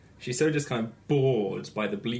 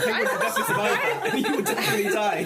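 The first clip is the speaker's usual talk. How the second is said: laughing; 10 semitones higher, 3 dB louder, faster than usual. Real, not acted. Teasing the survival story, laughing.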